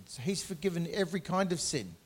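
ordinary delivery

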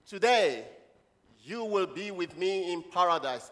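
loud, slow declamation; scripture proclamation. Acted. Preacher voices Jesus' promise to the church, proclaimed. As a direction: call out the first word, pause, then stretch the promise. Models scripture for congregation to repeat.

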